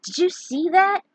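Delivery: a touch of awe in the voice; amazed. Acted. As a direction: burst out in wonder. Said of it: "Snow asked in amazement".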